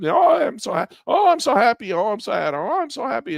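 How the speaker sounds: very high, sing-out imitation of joy; mock elation. Investor as the market goes back up, mock elation. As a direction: play a giddy investor, way up in pitch. Voicing relieved investors riding it out.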